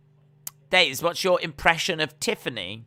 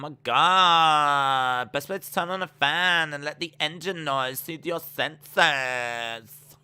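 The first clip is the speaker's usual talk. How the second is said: mock female voice, sentence endings held long; 2 semitones higher, 3 dB louder than usual. Streamer reading a viewer's message in mock female drawl. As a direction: draw out "god" and "senses" loudly. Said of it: "hold on to the end of the sentence".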